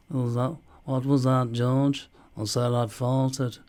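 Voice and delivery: slow, badly faked Liverpool accent; attempted Liverpool accent. Shown as a demonstration. Acting out a chat with [name], badly attempted Liverpool accent. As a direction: act out a little exchange in dodgy Scouse. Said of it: "I can't fucking do it".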